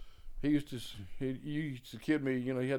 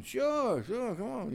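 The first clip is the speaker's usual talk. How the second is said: cheerful mock agreement; 10 semitones higher, slower than usual. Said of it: "Bird welcoming him to sit in".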